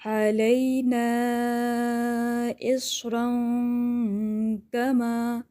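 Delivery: slow melodic tajweed chant; Quran recitation. Shown as a demonstration. Teacher demonstrates the verse: slow, chanted recitation. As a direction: draw the vowels out, chanting the verse. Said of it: "وہ کیسے ہوگا؟".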